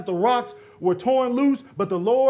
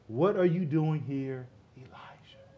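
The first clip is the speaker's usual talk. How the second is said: a barely audible question from God; still small voice; 6 semitones lower, 7 dB quieter than usual. A narrator's reading. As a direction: lower and much softer, nearly gone. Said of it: "a still, small voice".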